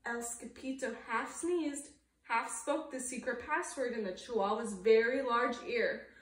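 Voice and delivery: ordinary delivery, nothing marked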